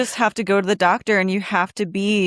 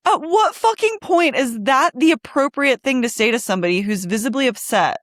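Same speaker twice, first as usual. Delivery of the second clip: real outrage; 5 semitones higher than usual. Real, not acted. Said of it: "I get murderous".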